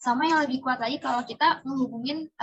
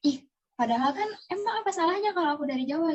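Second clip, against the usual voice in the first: higher, hurt and indignant; a raised, wounded protest; indignant inner voice; 6 semitones higher than usual. Retold or imitated. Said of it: "Jadi tuh bikin kayak". (dengan nada tinggi, kesal dan sakit hati)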